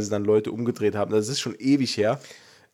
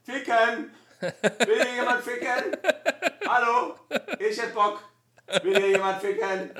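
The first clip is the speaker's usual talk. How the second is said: high mock bird shout; horny bird call; 10 semitones higher than usual. Imitating a horny bird calling.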